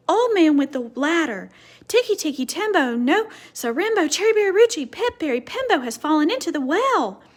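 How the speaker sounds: high, loud desperate shout; last breath of a yell; boy shouting with his last breath. Acted. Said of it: "he shouted".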